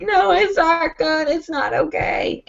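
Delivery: high, slow mock wail; hammed-up panicky whine; panicked wail. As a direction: re-enact distress, stretching each word high. Retold panicked reply to mom.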